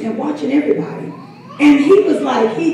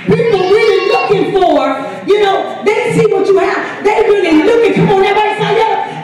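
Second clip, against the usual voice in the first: preaching; 4 semitones higher than usual. Acted. Pastor urging the congregation to look, preaching full out. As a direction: drive it home to the congregation.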